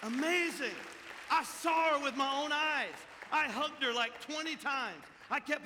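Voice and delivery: loud, high preacher's cry; shouting praise from the pulpit; preaching at full force. Real, not acted. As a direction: lift the room with it. Preaching climax.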